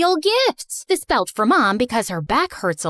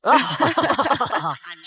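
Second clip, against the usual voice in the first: con man's smug laugh. Acted. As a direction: laugh at your own joke smugly. Con man laughs after retort.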